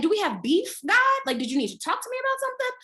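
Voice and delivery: indignant question to God. Retold or imitated. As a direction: call God out in a rush. Complaining to God.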